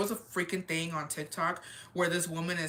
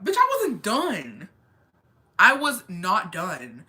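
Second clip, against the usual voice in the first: indignant outburst; 6 dB louder, much slower than usual. Real, not acted. Cut off mid therapy session.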